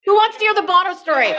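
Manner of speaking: rallying the audience. Real, not acted. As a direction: shout it out to get a crowd cheering.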